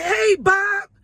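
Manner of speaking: very high, loud shouted greeting; matching loud cheer. Retold or imitated. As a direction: throw the same energy right back. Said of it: "came back on him same way".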